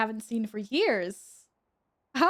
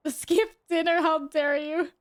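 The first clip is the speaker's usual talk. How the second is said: mock outrage; 6 semitones higher, 4 dB louder, slower than usual. Mock outrage on behalf of cat.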